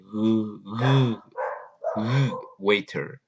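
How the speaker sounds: «G, g, g, waiter» con la W repetida y golpeada; sound out the W. Shown as a demonstration. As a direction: repite el sonido inicial antes de «waiter». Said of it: "empieza con W". (suena la W de «waiter» varias veces)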